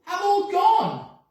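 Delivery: high, slow wailing cry; alarmed cry. A narrator's reading. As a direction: wail it in dismay. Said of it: "cried out Peter".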